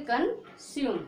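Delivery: "कंज्यूम" split after the first syllable; model pronunciation. Shown as a demonstration. Teacher answers own drill question, model pronunciation "कंज्यूम". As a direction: say "कंज्यूम" with yu and silent final e. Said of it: "तो क्या पढ़ोगे".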